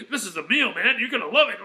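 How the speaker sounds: high, loud, laughing bartender quote. Retold or imitated. Bartender promising the best meal, laughing loud.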